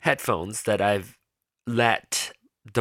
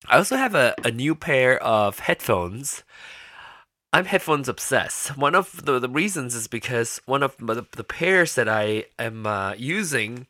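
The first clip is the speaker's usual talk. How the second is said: usual speaking voice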